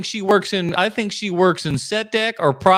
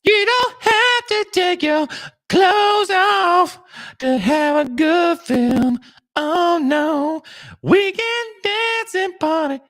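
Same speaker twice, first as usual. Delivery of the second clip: much higher, slow silly voice; high put-on voice; 13 semitones higher, slower than usual. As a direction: sing it out in a prissy falsetto.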